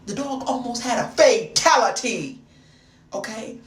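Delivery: ordinary talk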